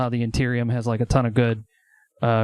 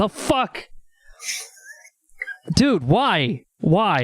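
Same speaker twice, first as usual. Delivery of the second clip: startled; 6 semitones higher, much slower than usual. Acted. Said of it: "He goes".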